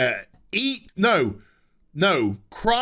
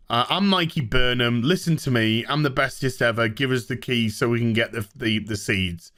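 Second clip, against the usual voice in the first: mock Burnham; 8 semitones lower than usual. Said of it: "they're just like".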